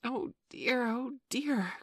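high-pitched, fretful oh dear; dismayed cry. A narrator's reading. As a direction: cry out like an overwhelmed little mother. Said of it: "cried Wendy".

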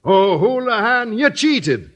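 high, slow accusing outcry; accusing cry. Acted. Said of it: "cried Sweeney".

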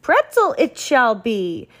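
grand royal proclamation; declaring. Acted. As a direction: announce it like a decree. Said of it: "he declared".

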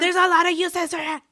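loud high mock yell. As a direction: belt it out in a sharp playful yell. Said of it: "Stop yelling at me".

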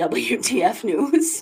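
ordinary delivery

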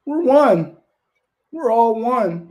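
high, loud mock rallying cry; mocking cry of unity. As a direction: belt it out in mockery. Mocks the claim of unity.

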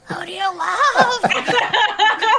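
high, drawn-out strained wail; no-oxygen voice. Acted. Hacker on intercom without oxygen, high strained drawl. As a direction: stretch LOVE out long and high. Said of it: "Don't forget there's no oxygen".

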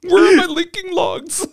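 very high, slow words through laughter; laughing too hard to speak; helpless laughter. Pretend. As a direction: squeal it out while cracking up. Joking, breaking up with laughter.

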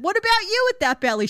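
pointed comeback; husband comeback. Retold or imitated. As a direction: finally snap back at her.

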